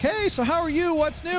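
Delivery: lightly voiced high chatty greeting; mock small talk. Imitating people at the games with a lightly high chatty hello. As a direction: chirp it like a friendly fan. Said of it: "everyone's like".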